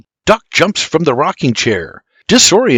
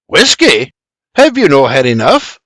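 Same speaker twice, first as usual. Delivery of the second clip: incredulous scoff, slightly raised; 4 dB louder than usual. A narrator's reading. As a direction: scoff at the request. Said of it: "Geoffrey scoffs".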